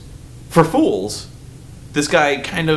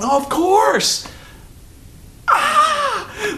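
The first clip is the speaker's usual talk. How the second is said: delighted realization; much slower than usual. Retold or imitated. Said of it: "I was going".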